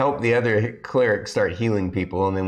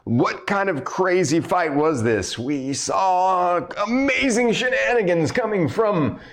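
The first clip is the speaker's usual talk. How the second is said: arena announcer; 13 semitones higher than usual. Acted. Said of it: "the announcer's like".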